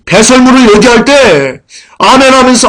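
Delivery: loud, high-pitched sermon delivery; forceful preaching. Real, not acted.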